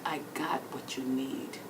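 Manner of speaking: slow, whispered, suggestive offer; sly whisper. Retold or imitated. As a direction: slip it to her like a secret deal. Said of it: "he would always tell me".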